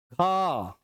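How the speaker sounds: long, breath-heavy "ख"; drawn-out aspirate. Shown as a demonstration. Teacher repeating the example for students, "ख" drawn out. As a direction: hold "ख" out on a stream of air. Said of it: "थोड़ा खींच के बोलते हैं".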